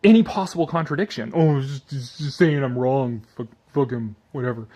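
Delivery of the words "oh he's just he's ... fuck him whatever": a hint of a brushing-off internet guy; mocking atheist. Youtuber mimics atheist commenter, mild dismissive voice. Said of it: "internet atheist guy is preempting".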